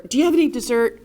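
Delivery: high waitress voice; a server breaking in with a high question. Retold or imitated. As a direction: pop in brightly like a server at the table. Server interrupts the ask.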